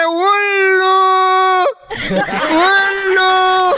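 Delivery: high, drawn-out mock wifely call; wife's call. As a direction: answer the husband in a stretched sing-song. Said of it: "પેલી સિલ્લુ બહાર નીકળીને એને કે". (પત્નીનો ઊંચો લંબાવેલો સાદ)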